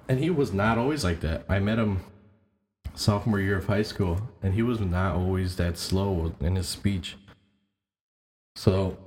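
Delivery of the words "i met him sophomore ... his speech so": ordinary delivery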